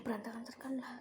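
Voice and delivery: whispered aside. Real, not acted. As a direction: breathe it out unvoiced.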